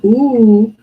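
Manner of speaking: loud, thrilled ooh; excited ooh. Real, not acted. Planning tomorrow's lasagna dinner, a loud thrilled ooh. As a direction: squeal with food joy.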